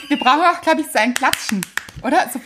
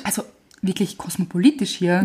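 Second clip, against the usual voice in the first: hushed whisper; 3 semitones lower than usual. Real, not acted. Whispered joke.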